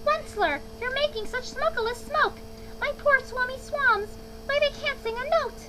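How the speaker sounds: very high, complaining creature voice; querulous Lorax croak. A narrator's reading. Lorax protesting the smoke choking his swans, querulous. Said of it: "he cried with a querulous croak".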